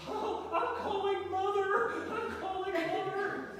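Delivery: high, slow tattling whine; whiny threat. Retold or imitated. Husband whining to his wife that he will tell Mother, high and slow. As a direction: drag it out like a spoiled child.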